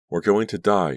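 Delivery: high, loud panicked cry; terrified scream. A narrator's reading. Danielle screams in the falling van, terrified and loud. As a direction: scream it in terror. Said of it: "she screamed aloud".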